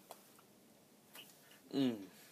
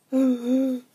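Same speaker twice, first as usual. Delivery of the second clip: loud high gasp; sharp gasp; 12 semitones higher, 8 dB louder than usual. Real, not acted. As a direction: gasp in shock. Gasp in distress.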